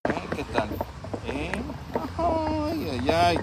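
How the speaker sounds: ordinary talk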